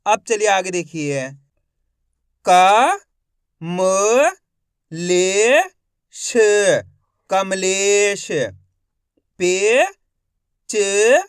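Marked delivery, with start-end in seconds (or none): 2.5-6.4 each syllable called out loud and separate. Shown as a demonstration. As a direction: sound out every syllable slowly with a pause. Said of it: "एक एक अक्षर का उच्चारण करके".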